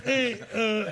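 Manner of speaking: usual speaking voice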